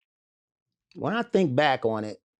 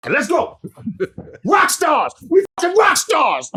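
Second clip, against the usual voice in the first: excited shout; 13 semitones higher, 8 dB louder than usual. Retold or imitated. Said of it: "So he like".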